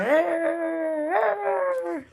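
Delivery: long, high cat wail; drawn-out high feline whine; imitated cat-fight yowl. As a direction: yowl like cats fighting by the window. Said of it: "They be like".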